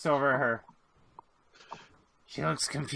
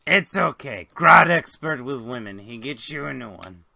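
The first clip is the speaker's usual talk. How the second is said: a hint of a rough-and-ready buddy, louder at first; lightly voiced Grick; 7 dB louder than usual. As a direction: lightly play the consoling sidekick. Game master voices Grick consoling.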